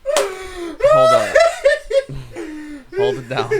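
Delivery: real high-pitched laughing, words squeezed out; helpless laughter. Real, not acted. Reacting to a terrible new song, helpless laughter. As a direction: barely get words out through laughter. Cracking up after hearing the song.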